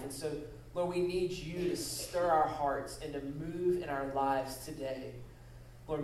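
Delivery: soft, unhurried pastoral prayer. Acted. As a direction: pray gently to God before the congregation. Pastor leads opening prayer.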